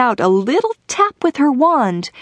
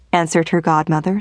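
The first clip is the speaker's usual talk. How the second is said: ordinary delivery, nothing marked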